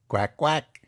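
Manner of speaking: short paired quacks, rising; small duck noises in twos. Acted. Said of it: "says the duck".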